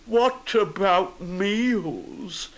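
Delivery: very slow, high lament; plaintive storybook bear; gloomy Pooh. A narrator's reading. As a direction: draw it out woefully as a bear. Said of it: "said Winnie the Pooh gloomily".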